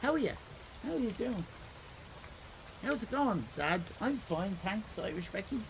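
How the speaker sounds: high, lightly exaggerated Irish greeting; put-on Irish accent. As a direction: gently ham up an Irish accent for a skit. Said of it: "You, you say something Irish".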